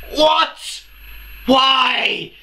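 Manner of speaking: ranting at fans who renamed the fandom; outraged shout. Real, not acted. To fans, outraged yelling. Outraged at the fan name change.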